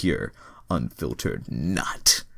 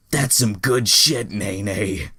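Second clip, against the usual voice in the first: loud, slow, higher drawn-out praise; ecstatic Jacob; 3 semitones higher, 6 dB louder, much slower than usual. Acted. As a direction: savor it, hammed-up.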